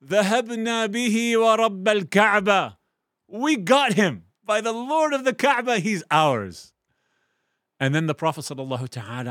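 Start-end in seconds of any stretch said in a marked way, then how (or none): 0.0-6.7 much higher, louder triumphant shouting; joyful loud cry. Acted. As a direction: cheer like a victorious crowd. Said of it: "their response was".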